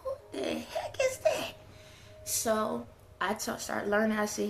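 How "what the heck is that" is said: much higher, slow, mock-baffled squeal with a laugh; a parent laughing at the new homework. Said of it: "it's like".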